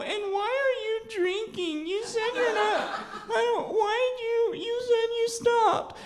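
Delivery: put-on very high, wailing child's voice, half crying; hammed-up sobbing little boy; freaking-out kid. Ten-year-old boy panicking to his drunk mom in a very high wailing voice. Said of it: "I was like freaking out".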